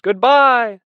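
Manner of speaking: high, loud goodbye cry; chorused farewell call. A narrator's reading. Animals seeing Boxer off, calling out loudly. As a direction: call it out as a whole crowd would. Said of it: "they chorused".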